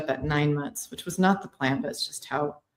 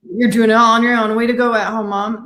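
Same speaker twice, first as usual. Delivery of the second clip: raised, louder imitation of praise; mock cheering; 5 semitones higher, 8 dB louder than usual. Voicing those who praise isolated moms, mock cheering. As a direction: cheer her on as if a hero.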